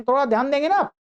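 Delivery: ordinary talk, nothing marked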